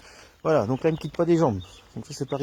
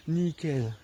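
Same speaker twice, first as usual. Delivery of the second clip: ordinary delivery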